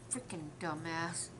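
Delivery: low, soft, slow muttered aside; muttered insult. Retold or imitated. Whispering to her husband about the customer, soft muttered insult. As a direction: mutter it under your breath. Couple muttering to each other.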